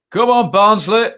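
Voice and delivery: a half-hearted mock Barnsley accent, cheering the team on; failed Barnsley accent. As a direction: have a go at a Barnsley accent and fall short. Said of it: "I can't say it in a Barnsley accent".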